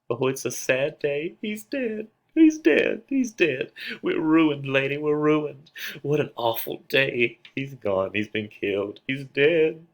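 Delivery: high, loud lamenting wail that breaks upward; wailing nurse. Acted. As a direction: cry out the death news like a distraught old nurse. Nurse lamenting Tybalt's death.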